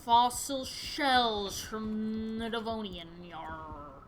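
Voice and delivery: very slow, drawn-out pirate voice; lethargic sea captain. Acted. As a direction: stall and stretch it like a sluggish whaler. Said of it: "lethargic whale man than a legitimate pirate".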